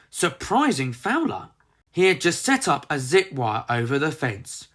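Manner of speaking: plain reading voice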